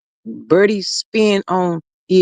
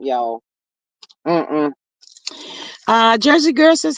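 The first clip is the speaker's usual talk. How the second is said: normal talking voice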